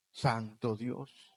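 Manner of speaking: soft, drawn-out whispered exclamation; very slow hushed awe. Acted. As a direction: let it out in awe. (asombro susurrado y lento)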